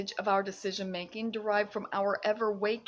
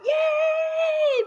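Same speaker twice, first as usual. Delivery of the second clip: much higher, loud yay; cheer; 7 dB louder than usual. Real, not acted. Cheering for viewers. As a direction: throw out a big cheer.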